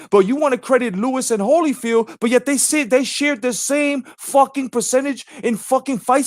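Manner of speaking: high, fired-up ranting at the chat; heated rant. Real, not acted.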